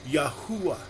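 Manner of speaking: Yahuwah said as yah-HOO-wah; alternative name pronunciation. Shown as a demonstration. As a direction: say Yahuwah with an "oo" in the middle. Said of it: "Another ministry may choose to pronounce".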